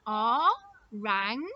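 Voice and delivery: "Orangutan" in four beats with long gaps; syllable by syllable. Shown as a demonstration. Teacher claps word with children, "Orangutan" in four beats. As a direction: clap out O, rang, u, tan separately. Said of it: "let's see".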